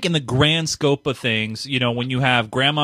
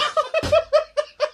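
high burst of laughing; breaking into laughter. Real, not acted. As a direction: crack up and lose it. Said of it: "I can't do that anymore".

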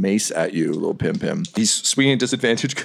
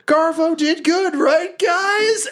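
very high-pitched, squeaky goblin; eager hobgoblin voice; slower than usual. Acted. Goblin Garvo turns to the party for approval, high and eager. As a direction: pitch it way up like a proud little goblin. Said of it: "Garvo turns around and he's like".

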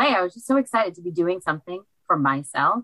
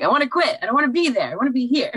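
high, louder half-joking rebel cry; playful outburst; 9 semitones higher, 3 dB louder, faster than usual. Retold or imitated. Ignoring her day job, half-joking giddy declaration. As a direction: blurt it out like a giddy escape fantasy. Retells wanting to quit real job.

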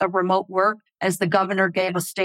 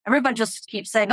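ordinary delivery

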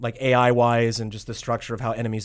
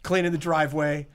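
laughing mock rant; 5 semitones higher than usual. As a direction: lecture him while cracking up. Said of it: "He's like".

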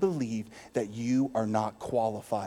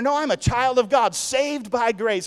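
proclaimed; 9 semitones higher, 4 dB louder than usual. Real, not acted. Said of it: "I'm not just gonna say".

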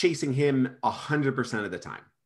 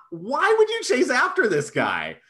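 high, louder outraged question; exasperated disbelief; 11 semitones higher, 4 dB louder than usual. Real, not acted. Co-host ranting about the heroine, high and outraged. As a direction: demand an answer in disbelief. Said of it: "ranting disbelief".

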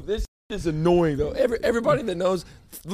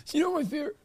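mild mock imitation, higher and softer; mocking co-host; 7 semitones higher, 6 dB quieter, much faster than usual. Mocking co-host's happy snuggle question, higher and softer. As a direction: lightly tease his happy pucker. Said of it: "And you, like, perked them".

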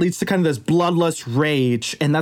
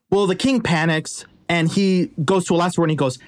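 ordinary delivery, nothing marked

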